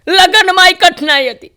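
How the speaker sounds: high, loud fed-up complaint; exasperated groom. Retold or imitated. Newlywed groom complains to the comedian, high and exasperated. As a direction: wave it off, fed up.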